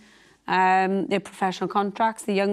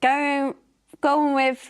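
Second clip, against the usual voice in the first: higher, lilting mock Welsh accent that slipped in by mistake; a failed Australian accent that drifts Welsh, pitched up; accidental Welsh lilt in place of Australian; slipping into Welsh; 7 semitones higher, much slower than usual. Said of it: "no, that's Welsh".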